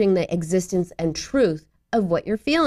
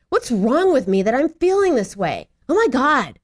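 exasperated, higher-pitched self-scolding; angry; 4 semitones higher than usual. Acted. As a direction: snap at yourself in mock anger. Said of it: "Do you get angry?".

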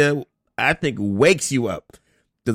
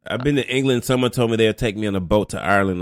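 ordinary talk

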